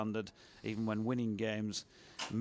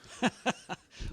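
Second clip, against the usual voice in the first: laughter; 13 semitones higher than usual. Real, not acted. Laughing at score.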